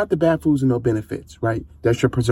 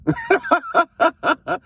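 high laugh. Real, not acted. Coach laughing at his fish burps, high laugh. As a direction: burst of high-pitched laughter.

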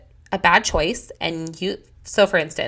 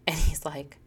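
hushed; 3 semitones lower, 3 dB quieter than usual. Real, not acted.

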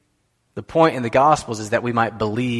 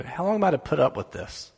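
softer, exasperated question; understated fed-up plea, voice dropped; quietly at the end of patience; frustrated; 3 semitones higher, 6 dB quieter than usual. Retold or imitated. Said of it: "he's frustrated with the people".

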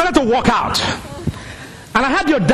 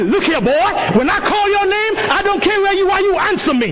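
put-on a mother sternly warning her boy to answer when called; 8 semitones higher than usual. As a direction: lay down the law to your son. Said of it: "She said to me".

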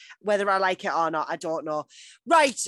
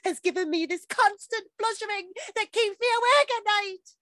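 Dawson monster voice; 9 semitones higher than usual. Pretend. Podcaster reads Dawson's love letter, climbing monster voice. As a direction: build higher and higher, overwrought. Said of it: "entire letter in Dawson monster voice".

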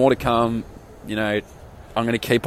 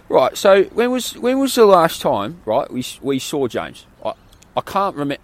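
put-on English accent; 7 semitones higher than usual. Hostel guest retelling English guys puzzling over their mate, English accent. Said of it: "That was a pretty good accent".

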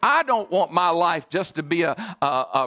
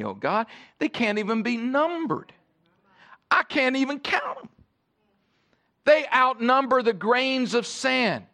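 builds higher in awe over God's thoughts; rising wonder; 8 semitones higher than usual. Shown as a demonstration. Pastor reading the psalm to the church with rising awe. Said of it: "The, the psalmist says".

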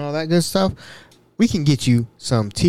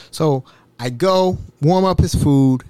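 ordinary talk, nothing marked

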